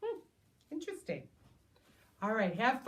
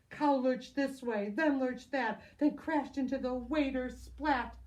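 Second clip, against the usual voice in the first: ordinary delivery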